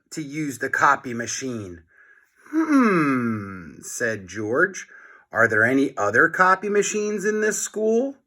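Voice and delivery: lively storybook read-aloud voice; reading to children. A narrator's reading. Teacher reads chapter aloud to class.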